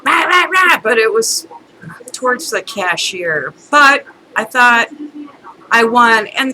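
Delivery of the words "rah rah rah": high mock yapping; raised-pitch nagging gibberish; imitated ranting noise. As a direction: mimic a rant as nonsense barks. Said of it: "she just".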